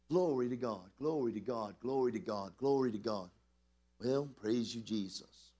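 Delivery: low, repeated murmurs of praise; worshipful praise. Real, not acted. Praise and prayer before congregation.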